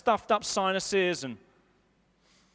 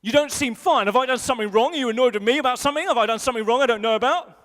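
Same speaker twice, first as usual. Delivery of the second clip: louder, fast rush of worried questions; anxious wife's questions; 5 dB louder, faster than usual. Retold or imitated. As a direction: pester him, a flood of anxious questions. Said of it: "She'll be like".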